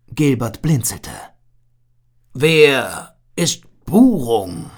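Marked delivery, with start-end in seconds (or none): 2.3-4.7 slow, friendly big seal; slow, hearty seal creature. A narrator's reading. Seal asks puzzled. (langsame, gutmütige Seehundstimme)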